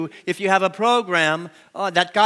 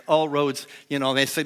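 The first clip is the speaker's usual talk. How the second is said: ordinary delivery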